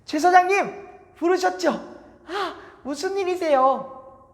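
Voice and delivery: put-on eager boy rushing to the priest; young Samuel. 어린 사무엘 목소리. (높고 큰 어린 사무엘 목소리)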